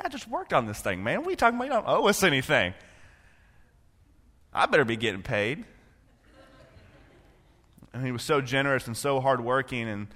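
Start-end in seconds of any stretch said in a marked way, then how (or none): 0.0-5.6 mock-outraged young self, pitch jumping up; indignant teen protest. Teen complaining to grandfather, mock outrage. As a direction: protest like a cheated kid.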